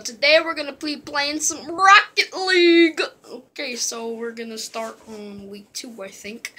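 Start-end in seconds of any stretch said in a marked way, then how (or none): 0.0-3.0 high, very loud video-opener shout; hyped intro announcer. Acted. Gamer greeting viewers to a Rocket League video, hyped announcer shout. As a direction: belt out the welcome like a hype announcer.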